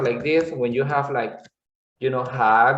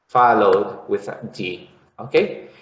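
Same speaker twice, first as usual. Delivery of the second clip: normal talking voice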